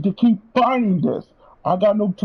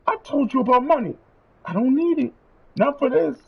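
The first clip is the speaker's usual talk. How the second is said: ordinary talk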